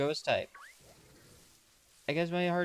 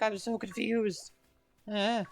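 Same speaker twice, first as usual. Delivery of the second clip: mock confused; 7 semitones higher, slower than usual. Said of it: "I'm over here just like".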